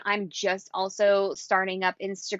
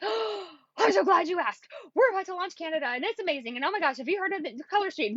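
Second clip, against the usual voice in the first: high, fast, gushing sales pitch; overexcited babble; 7 semitones higher, faster than usual. Retold or imitated. Pitching a new contact too eagerly, a fast high gush. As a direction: spill everything at once, way too eager. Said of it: "you're gonna wanna talk a mile a minute".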